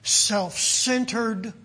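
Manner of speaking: word by word with long pauses; measured preaching, each word left to hang; deliberate emphasis. Real, not acted. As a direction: weigh each word for the congregation.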